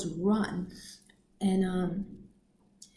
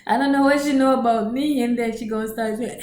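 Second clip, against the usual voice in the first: ordinary talk, nothing marked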